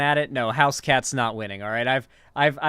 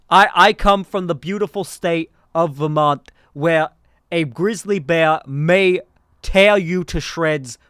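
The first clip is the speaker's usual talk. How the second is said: Bernie; 5 dB louder than usual. Acted. Candidate telling voters about grizzlies back home, emphatic Bernie impression. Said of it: "I'm doing a Bernie impression".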